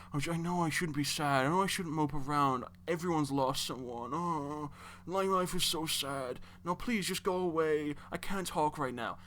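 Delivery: mock a miserable, self-pitying moaner; hammed-up tearful whine; Jun Long. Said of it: "Jun is a pathetic crybaby".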